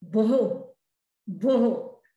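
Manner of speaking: भो said with a breathy h release at the end; visarga sound. Shown as a demonstration. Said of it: "विसर्ग है, इसलिए ह जैसी साउंड आएगी". (भो के अंत में ह जैसी विसर्ग ध्वनि)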